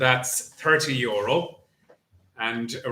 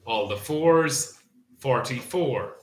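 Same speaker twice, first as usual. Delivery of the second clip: bingo caller; 3 semitones higher than usual. Acted. Bingo number calling.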